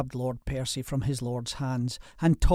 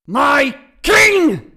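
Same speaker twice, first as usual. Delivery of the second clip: a furious, much higher, much louder roar; thundering roar; 15 dB louder than usual. A narrator's reading. Grieving lord roaring at his enemy, thundering. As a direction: make the ceilings ring. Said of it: "voice thundered".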